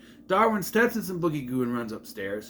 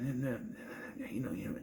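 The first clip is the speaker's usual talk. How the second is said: very soft, hushed chatter; mock whispering; 3 semitones lower, 14 dB quieter than usual. Pupils quietly chatting in class, whispering. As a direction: imitate pupils whispering under their breath. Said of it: "And we're just going".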